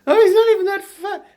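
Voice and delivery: high squeaky mock reassurance; mocking falsetto. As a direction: squeal it like a fake comforter. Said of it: "you know".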